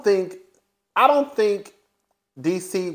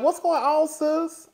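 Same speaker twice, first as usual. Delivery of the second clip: much higher, teasing "What's going on, sis?"; lightly voiced squeaky gossip to a friend; mock high girlfriend voice; 13 semitones higher, slower than usual. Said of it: "Irena, like".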